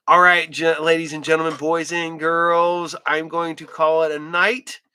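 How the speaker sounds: loud showman ladies and gentlemen; announcer sign-off. Real, not acted.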